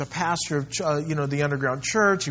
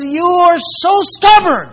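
high, loud, slow furious yelling at a stubborn prisoner; 12 semitones higher, 11 dB louder, slower than usual. Retold or imitated. The exhausted guard yells at the stubborn pastor, high, loud and furious. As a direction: roar it out, spent and enraged at him. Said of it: "He got so mad at him".

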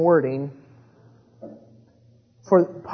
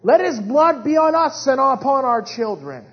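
high, raised-voice imitation of a yelling crowd; preacher's mock crowd shout; retold crowd yell; 8 semitones higher, 7 dB louder than usual. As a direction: cry it out like the mob, short of screaming.

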